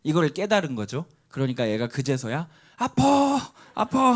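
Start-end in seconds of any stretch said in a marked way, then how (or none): 2.8-4.2 put-on high, crying child calling out in pain. Father imitating his son crying out when he finally feels the hits. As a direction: wail it like a little boy finally giving in. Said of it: "하면서 울더라고요".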